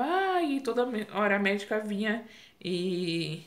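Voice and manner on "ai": long high-pitched "ai" wail; mock pain scream. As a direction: imitate a labour cry, held back in volume. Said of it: "na torneira e gritava".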